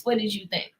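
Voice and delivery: ordinary talk, nothing marked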